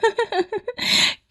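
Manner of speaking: high cackling laugh; eerie high-pitched cackle; witch laugh. Acted. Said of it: "हंसते हुए कहती है".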